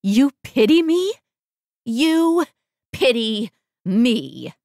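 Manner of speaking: high, loud, outraged outburst; erupt, then drag the words out slowly; enraged disbelief. Acted. Sick woman enraged at being pitied, loud outrage.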